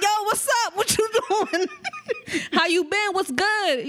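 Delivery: high, eager chatter in the ear; mock a loud friend who won't stop; a hammed-up loud greeting; loud pestering talker. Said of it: "somebody keep being loud".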